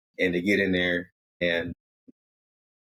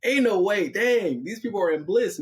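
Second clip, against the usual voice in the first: high-pitched, awestruck disbelief; floored and wowed, pitched way up; overwhelmed amazement; 12 semitones higher than usual. Acted. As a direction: marvel at it in a raised, acted voice. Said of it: "that really breaks Jonas".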